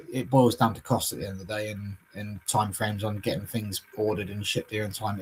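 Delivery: usual speaking voice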